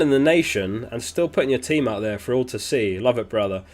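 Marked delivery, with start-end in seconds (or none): none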